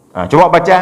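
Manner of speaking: normal talking voice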